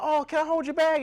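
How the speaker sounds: high, put-on sweet asking voice; mock nosy friend. Mimicking a friend who wants to hold the bag, high and sugary. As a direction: play the friend grabbing at your bag. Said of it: "They're like".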